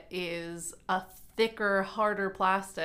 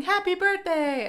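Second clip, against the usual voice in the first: mock birthday greeting; 11 semitones higher, 6 dB louder than usual. Mimicking birthday replies again.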